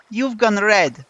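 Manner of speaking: high, loud teasing cry; excited. A narrator's reading. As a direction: tease him, thrilled. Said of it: "Yulia said excitedly".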